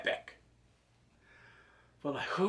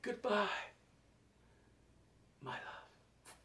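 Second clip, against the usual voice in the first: fading, whispered last words; dying whisper; 5 semitones higher, 9 dB quieter, much slower than usual. Retold or imitated. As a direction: let it fade out on a dying breath.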